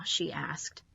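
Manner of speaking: usual speaking voice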